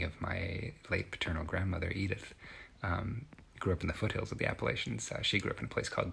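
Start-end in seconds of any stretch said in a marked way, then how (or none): none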